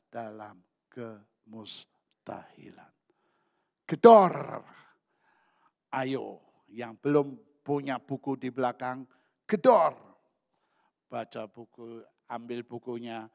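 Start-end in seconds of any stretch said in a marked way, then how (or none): 3.9-4.6 loud, high, falling shout; shouted call. Real, not acted. Preacher rousing the congregation, one loud shouted word. Preacher calls out a punchy cue. 9.5-9.9 loud, high, falling shout; shouted call. Real, not acted. Preacher rousing the congregation, one loud shouted word. Same cue called out again.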